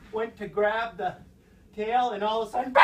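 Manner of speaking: ordinary delivery, nothing marked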